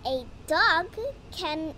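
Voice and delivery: usual speaking voice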